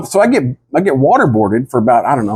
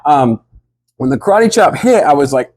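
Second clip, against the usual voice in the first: normal talking voice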